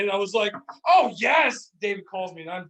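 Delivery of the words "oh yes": loud, higher retold whoop of glee; mock triumphant shout; excited cheer. Retelling drive-thru prank, a loud higher gleeful yes.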